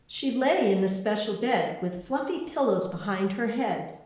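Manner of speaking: ordinary delivery, nothing marked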